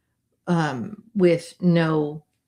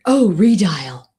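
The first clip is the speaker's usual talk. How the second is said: excited realisation; 8 dB louder, slower than usual. Real, not acted.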